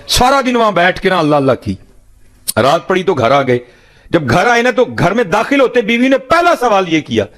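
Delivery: high, soaring sermon narration; preacher lifting the story to a peak. Acted.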